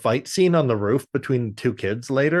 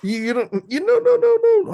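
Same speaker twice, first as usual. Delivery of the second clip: high loud sputtering no no no; flustered refusal; 5 dB louder than usual. Retold or imitated. Friend mimics granddad frantically refusing at the door. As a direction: splutter in alarm.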